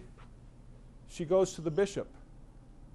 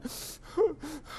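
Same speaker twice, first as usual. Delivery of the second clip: high, acted child's sob; mock sobbing gasp; 12 semitones higher than usual. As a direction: gasp in like a hurt child starting to cry. Hurt child crying, acted.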